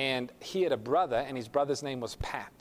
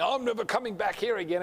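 higher-pitched grumbling protest; mock indignant vow; 7 semitones higher than usual. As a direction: imitate someone storming out. Angry exit from church, acted.

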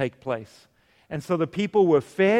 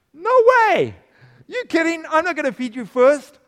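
much higher and louder, a shocked "no way"; incredulous refusal; 11 semitones higher, 7 dB louder than usual. Retold or imitated. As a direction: blurt it out like she can't believe the nerve. Widow refuses Elijah's request.

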